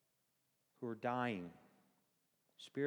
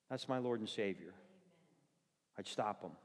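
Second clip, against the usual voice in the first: normal talking voice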